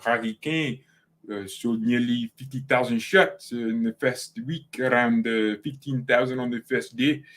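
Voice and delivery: reading aloud in a put-on French accent; mock French accent. Shown as a demonstration. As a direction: read it like a Frenchman, with hesitant uhs. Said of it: "let me read this in my French accent".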